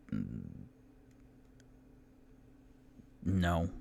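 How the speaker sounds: low, quiet mm before a curt no; lightly voiced gruff innkeeper. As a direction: Durnan grumbles a short refusal. Durnan refuses the keys.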